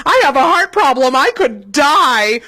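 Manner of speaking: a scared mother catching her breath; breathless panic. Retold or imitated. Mother to her laughing son after the spider scare, loud breathless panic.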